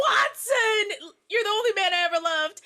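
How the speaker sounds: high, loud melodramatic wail; mock anguished scream. [name] crying out over fallen Watson, hammed-up scream. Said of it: "Why did he scream like that".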